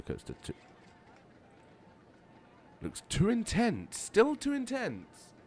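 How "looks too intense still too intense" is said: high, slow, incredulous squeal; very high, stretched-out exasperation; exasperated disbelief. Real, not acted. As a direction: draw it out in shrieky disbelief. Reading guests' complaints in disbelief.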